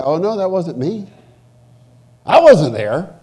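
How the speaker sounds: mock denial. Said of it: "And they'll say".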